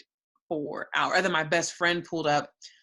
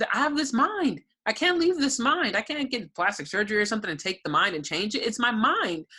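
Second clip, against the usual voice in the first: rising to a higher, louder cry of despair; desperate outcry; 6 semitones higher, 4 dB louder than usual. Retold or imitated. Telling husband and friend in the street she can't escape her mind, desperate and loud. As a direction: plead louder and higher, voice climbing.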